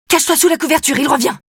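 high, urgent alarm. Acted. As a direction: warn her, high and urgent. Sophie rushes back in alarm.

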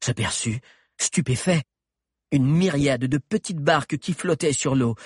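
plain reading voice

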